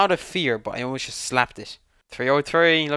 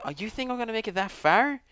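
normal talking voice

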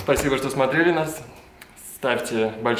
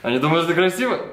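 mock outrage; 6 semitones higher, 7 dB louder than usual. Comic rant about rats.

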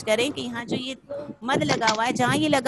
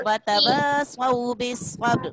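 slow, drawn-out Quranic recitation; teacher reciting for children to repeat; much slower than usual. Shown as a demonstration. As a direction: chant it slowly and evenly for the class to follow.

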